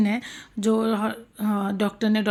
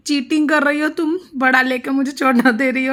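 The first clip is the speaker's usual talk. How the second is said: imitating the family's indignant accusation, higher and a bit louder; teasing mock protest, raised pitch; mock outrage; 3 semitones higher, 5 dB louder than usual. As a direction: playfully act out the father and daughter crying cheating. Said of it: "दोनों बाप बेटी भड़क गए".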